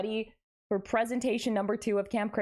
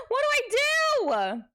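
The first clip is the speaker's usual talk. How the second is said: gasp, then a slow, much higher, louder plea; mock panicked gasp; 5 dB louder, slower than usual. Streamer begging chat for help as stream drops, mock panic. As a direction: play the helpless damsel, over the top. Connection failing again, gasps.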